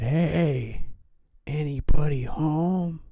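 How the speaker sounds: loud, high-pitched holler; calling out. A narrator's reading. Kids step further into the house, calling out loudly. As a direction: call through an empty house.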